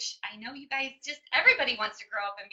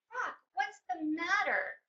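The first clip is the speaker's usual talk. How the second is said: higher, slow and gentle; tender concern; 6 semitones higher, slower than usual. Retold or imitated. Mom turns to crying baby.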